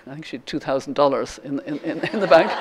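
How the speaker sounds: ordinary delivery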